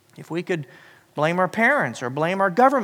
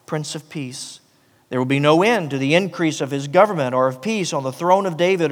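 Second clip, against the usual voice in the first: proclaimed scripture reading; 6 dB louder than usual. Acted. Pastor reading Isaiah to the church, proclaiming loud. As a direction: read it out like a royal proclamation.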